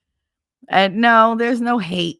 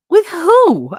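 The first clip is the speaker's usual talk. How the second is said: loud, high mock yell; imitating an incredulous friend; shocked question; 13 semitones higher, 10 dB louder than usual. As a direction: blurt it out in disbelief.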